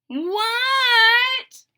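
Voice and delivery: loud high shouted answer. Acted. Froggy yells back to his father, shouting. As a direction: yell back from far off.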